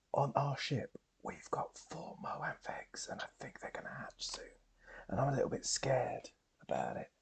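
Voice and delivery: very soft, slowing into breathy whisper as if sharing a secret; hushed confiding. Acted. Cara confides in Leah about the Moanth eggs, hushed whisper.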